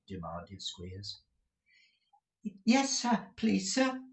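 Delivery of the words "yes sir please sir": put-on high, timid child's voice; little boy. The little boy meekly answering the master, high and timid. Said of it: "replied the little boy".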